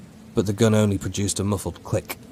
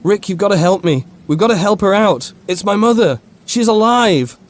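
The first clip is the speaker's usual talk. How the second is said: desperate pleading; 12 semitones higher, 8 dB louder than usual. A narrator's reading. Soldier pleads about his mother.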